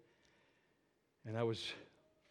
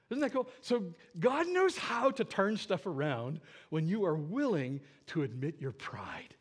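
usual speaking voice

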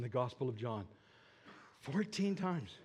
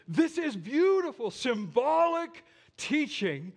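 awed wonder; 9 semitones higher, 6 dB louder, slower than usual. Acted. Preacher marvels at the burning bush.

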